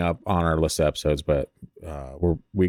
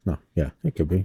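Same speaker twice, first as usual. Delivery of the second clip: normal talking voice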